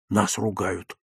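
understated fear; frightened. A narrator's reading. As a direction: softly voice alarm to a friend. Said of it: "испугался Лёвка".

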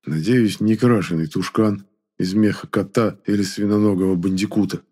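ordinary delivery, nothing marked

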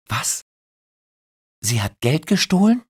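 high, loud shocked question. Acted. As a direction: gasp out the question. Christine shocked.

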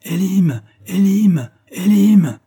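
high, loud repeated shouts of a name; calling out. A narrator's reading. Officers recognise Elim and cry his name, shouting. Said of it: "crièrent vingt voix d'officiers".

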